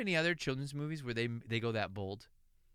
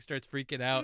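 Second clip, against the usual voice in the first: usual speaking voice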